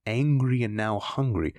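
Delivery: plain reading voice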